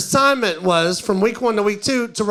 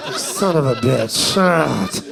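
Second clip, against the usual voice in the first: muttered aside; 7 semitones lower, slower than usual. Real, not acted. Aside after the student's answer.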